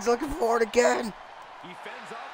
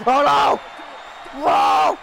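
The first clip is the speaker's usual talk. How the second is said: loud, raised exclamations; alarmed shout; 3 semitones higher, 3 dB louder, slower than usual. Real, not acted. Gamer watching a climb go wrong, alarmed shout. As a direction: yell out in sudden alarm. Reacting to a big move.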